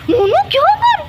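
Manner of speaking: high-pitched scolding cry; exasperated cry. Real, not acted. Pet will not stop bothering her. (झुंझलाकर ऊँची आवाज़ में)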